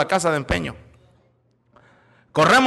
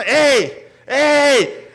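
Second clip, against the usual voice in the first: raised-voice "hey" to get attention; father's sharp call; 6 semitones higher than usual. Acted. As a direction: act out Dad calling a panicking child. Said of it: "Hasta que me dijo". (llamada enérgica del papá)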